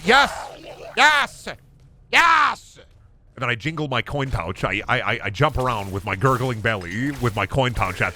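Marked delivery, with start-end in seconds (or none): 0.0-2.8 high, loud, eager yes; ecstatic yells. Retold or imitated. Gamer cheering for the shop item, loud yells. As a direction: shout it like a greedy pig getting its fix. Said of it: "And I say".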